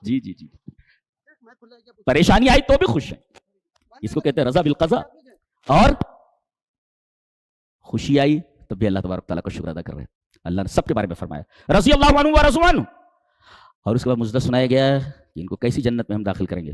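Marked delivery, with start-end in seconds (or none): 2.0-3.2 raised preaching. Acted. 11.8-12.8 high, loud ringing recitation of the verse; raised Quranic recitation. Acted. Preacher reciting the verse to the congregation, loud and high.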